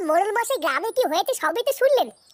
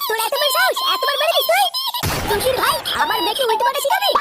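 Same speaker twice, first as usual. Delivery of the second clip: angry scolding; 12 semitones higher than usual. Acted. Angry father yells at the boy for teaching his daughter swearing. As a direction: tear into the kid. Bully berates the boy angrily.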